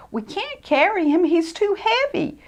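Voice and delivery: high, small fretful voice; worried church mouse. Acted. A church mouse worrying about carrying Ted. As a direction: fret helplessly.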